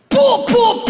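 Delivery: high loud pou pou catcall; catcall noises. Retold or imitated. Harasser catcalling a girl walking by, loud pops. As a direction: fire off catcalls at a passing girl.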